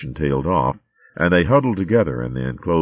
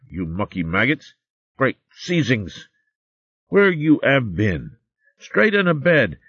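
impression of Alma; 5 semitones higher than usual. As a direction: huff and scold like a fussy mother, played for laughs. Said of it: "did his impression of Alma".

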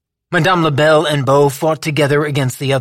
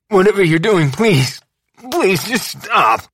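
pained pleading; 6 semitones higher than usual. A narrator's reading. Boz pleads in pain.

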